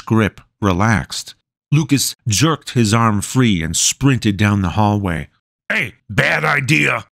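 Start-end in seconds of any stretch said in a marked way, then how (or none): 5.7-7.1 higher, ominous shouted warning; firm squad orders. Acted. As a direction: call after the runaway prisoner. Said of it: "the soldier's voice echoed ominously".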